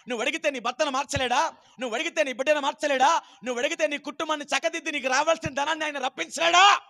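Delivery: fast, building to a shout; preaching crescendo. Real, not acted. Preacher challenging the congregation to ask, shouting crescendo. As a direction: hammer each question harder. Escalating rhetorical questions.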